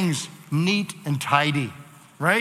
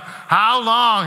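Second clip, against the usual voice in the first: higher, louder lament; crying out; 5 semitones higher, 5 dB louder than usual. Shown as a demonstration. Preacher voicing the psalmist's loud cry. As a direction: cry it to heaven. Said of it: "Four times there, the psalmist is crying out".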